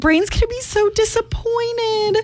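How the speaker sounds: high, drawn-out mock lament; retold dismay. Host retelling her plea to the shop employee, mock dismay. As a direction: stretch it into a pleading little wail.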